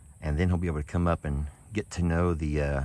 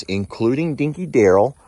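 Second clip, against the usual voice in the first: usual speaking voice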